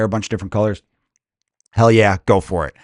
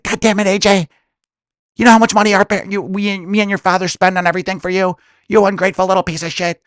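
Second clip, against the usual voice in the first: scolding mother; 8 semitones higher than usual. Acted. Mother scolding ungrateful son.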